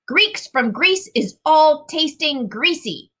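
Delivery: giant voice. A narrator's reading. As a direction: explain like a big simple giant.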